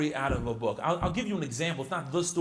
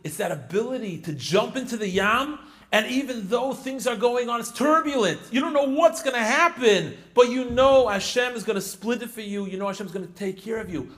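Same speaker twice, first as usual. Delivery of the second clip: higher, louder, rousing sermon build; preaching; 7 semitones higher, 7 dB louder than usual. Acted. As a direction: drive the message home with fervor. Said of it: "preaching the lesson".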